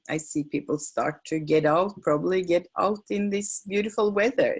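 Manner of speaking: usual speaking voice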